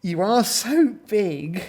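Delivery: high, slow, gushing compliment; admiring. A narrator's reading. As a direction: swoon over the hero. Gorillas swoon over hero Gordon.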